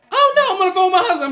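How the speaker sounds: high, loud, quick mock protest; retold loud refusal. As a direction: act out a hurried, high-pitched brush-off.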